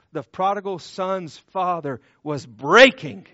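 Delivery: loud, falling pulpit lines, each word leaned on; impassioned preaching. Acted. Pastor to grieving parents, loud preaching on heartbreak. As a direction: build the grief to a loud peak.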